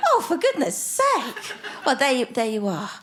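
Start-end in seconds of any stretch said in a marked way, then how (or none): 0.1-1.6 laughing exasperation. Real, not acted. Mock exasperation at four mowers.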